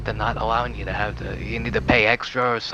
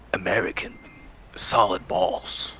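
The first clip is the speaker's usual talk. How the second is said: hissing whispered snake voice; 4 semitones lower, 5 dB quieter than usual. Retold or imitated. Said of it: "doing the snake voice".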